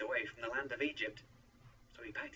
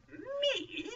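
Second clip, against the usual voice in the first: very high, loud squeak of disbelief; shocked exclamation; 6 dB louder than usual. A narrator's reading. Moses hears he is being sent, shocked exclamation. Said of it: "Moses exclaimed".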